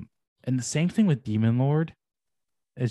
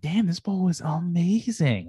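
imitating a delighted dad, higher pitch; super happy; 7 semitones higher, slower than usual. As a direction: gush over it, stretching the praise. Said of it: "he's super happy about it".

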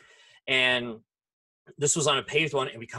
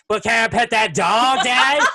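put-on higher, louder toddler pleading; insistent toddler; 8 semitones higher, 10 dB louder, slower than usual. Toddler ignoring dad, pleading loudly for the dog. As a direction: keep pleading like a stubborn tot. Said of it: "Kid did not fucking hear that".

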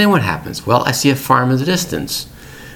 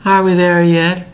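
higher, louder slow whine; whiny kid; 8 semitones higher, 4 dB louder than usual. Retold or imitated. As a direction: whine it like a bored child. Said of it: "the kids cannot say".

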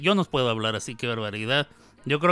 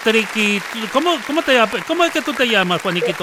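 excited host; 5 semitones higher, 5 dB louder than usual. Real, not acted. Locutora entrevista con entusiasmo.

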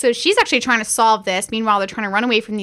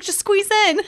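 higher, chipper push; higher peppy coaxing; mock coaching; 8 semitones higher, slower than usual. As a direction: brightly urge her to squeeze. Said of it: "He's like".

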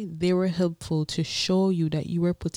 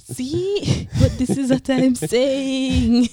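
much higher and louder, triumphant laughing tone; gleeful gotcha; 9 semitones higher, 7 dB louder, much slower than usual. Real, not acted. Wife teasing husband on their podcast, triumphant high gotcha. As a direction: pounce on the point like you caught him out. Teasing partner mid-disagreement.

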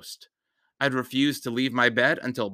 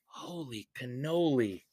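whisper; 6 dB quieter than usual. Acted. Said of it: "I whispered".

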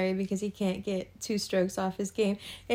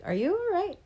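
surprised concern; 10 semitones higher than usual. Retold or imitated.

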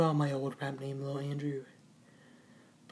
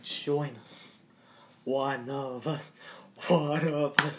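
creepy cult voice; 4 dB quieter than usual. Acted. Spooky cult chant.

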